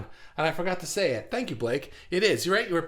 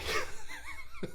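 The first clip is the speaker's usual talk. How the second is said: high giggle; 9 dB quieter than usual. Real, not acted. Laughing at own tangent.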